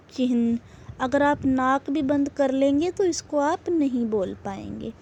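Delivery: normal talking voice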